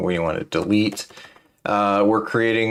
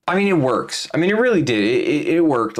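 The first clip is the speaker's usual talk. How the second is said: emphatic; 8 semitones higher than usual. Real, not acted. Defending a frustrating past setup.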